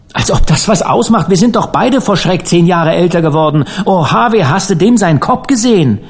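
high-pitched, shaken outburst; agitated horror. A narrator's reading. Two boys reliving the sight of the corpse, agitated horror. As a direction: build in agitation, pitch climbing.

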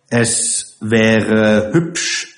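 drawn-out, deliberate delivery; very slow. Acted. Karlchen reproves the rude matron, very slowly. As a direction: stretch each word with cool restraint. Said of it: "sagte Karlchen langsam".

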